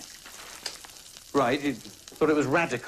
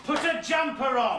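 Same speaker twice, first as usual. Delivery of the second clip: high yelling back and forth; angry shouting. Real, not acted. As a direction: yell it in a domestic row. Shouting row between the couple.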